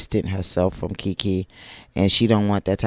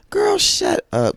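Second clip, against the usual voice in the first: exasperated; 5 dB louder, slower than usual. Real, not acted. Vlogger scolding Tisha on screen, high exasperated shriek. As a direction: fling it out high and drawn-out.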